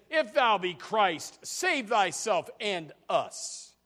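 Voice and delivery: drawn-out taunt of the dying thief; mocking thief. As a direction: sneer it out slowly like the mocking thief. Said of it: "he said".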